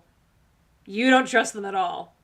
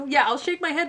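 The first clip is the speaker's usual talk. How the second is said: ordinary talk, nothing marked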